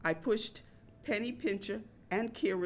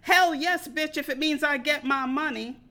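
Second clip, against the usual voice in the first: much louder, higher yell; inner voice shouting; 6 semitones higher, 8 dB louder than usual. Retold or imitated. Penny Pincher shouting back at the waitress, loud and high. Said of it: "Penny Pincher shouted".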